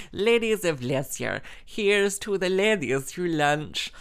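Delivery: playful, stretched theatrical proclamation; mock grand toast. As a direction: raise a hammed-up toast. Mock toast after laughing.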